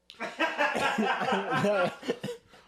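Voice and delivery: loud, high cackle; amused laughter. Real, not acted. Friend cracking up over a sad movie, loud laughter. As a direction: burst out laughing.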